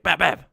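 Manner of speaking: loud, high bap bap; hitting sounds. Retold or imitated. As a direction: smack sound effects. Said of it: "I'm like".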